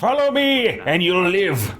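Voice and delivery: high, slow-building hammy command; mock heroic leader. Gamer mocking the game's hero, hammy high command. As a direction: ham it up like a pompous hero leading the way. Talking over game character dialogue.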